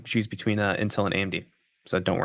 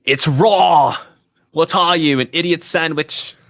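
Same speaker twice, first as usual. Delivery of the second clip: loud, higher fake British chef yelling, slowed at the end; furious British cook in a put-on accent; 6 semitones higher, 5 dB louder, slower than usual. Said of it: "it's my fake British accent".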